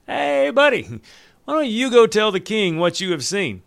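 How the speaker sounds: high, loud call to a messenger; Joab calling out. Acted. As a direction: hail him like a commander across camp.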